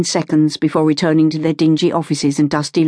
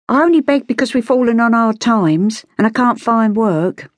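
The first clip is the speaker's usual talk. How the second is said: put-on high-pitched street-girl voice; high, aggrieved Cockney girl; Cockney beggar girl, protesting; 7 semitones higher than usual. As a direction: plead hard times in a high London accent. Street girl explains her poverty.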